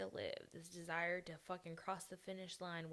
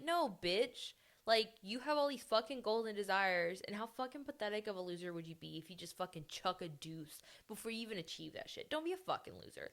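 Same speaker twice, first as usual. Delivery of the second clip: louder, fired-up mock scolding; tough-love rant; 3 semitones higher, 8 dB louder than usual. The friend she wishes would yell at her, loud scolding. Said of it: "Be like".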